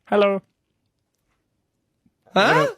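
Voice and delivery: squeaky cartoon-alien try, jumping way up on huh; a clumsy go at Stitch from Disney; bad Stitch impression. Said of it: "From Lilo and Stitch".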